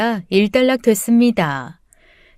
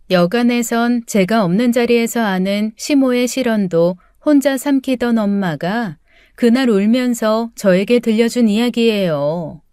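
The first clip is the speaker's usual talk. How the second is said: usual speaking voice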